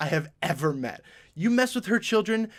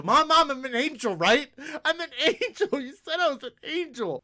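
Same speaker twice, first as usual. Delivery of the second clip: put-on high, laughing childish plea; pleading kid; 8 semitones higher than usual. Kid pleading with mom, high laughing wheedle.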